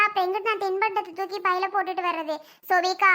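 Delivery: ordinary talk, nothing marked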